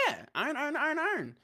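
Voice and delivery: squeaky high iron iron; very high nodding agreement. Retold or imitated. Friend retelling a video, squeaky high agreement. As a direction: chirp it up high while agreeing. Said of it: "He's like".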